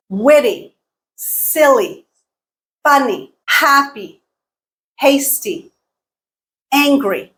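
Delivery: witty, silly, funny, happy, hasty, angry, each punched on its first syllable; first-syllable stress. Shown as a demonstration. Teacher giving adjective examples, witty to angry stressed on first syllables. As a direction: list witty to angry separately, each first syllable high, then falling. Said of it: "put the stress on the first syllable".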